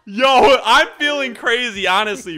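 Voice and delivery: hyped up. Real, not acted. Hyped after a big play.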